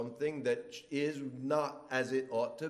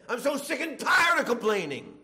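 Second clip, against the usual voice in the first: much higher and louder exasperated moan; fed-up whine; 9 semitones higher, 10 dB louder than usual. Retold or imitated. Complainer in the congregation, much higher, louder and whiny. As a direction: groan it out, sick of it all. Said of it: "You hear these kind of things".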